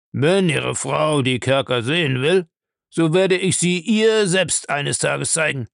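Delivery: put-on a gruff old man's voice, evasive; gruff old castle owner. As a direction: play the old castle lord brushing off the question. Bellamy offers to show dungeon.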